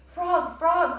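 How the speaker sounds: high, loud calling; cried out. A narrator's reading. Toad calls to Frog at the door, loud and high. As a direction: call out across to his house. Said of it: "cried Toad".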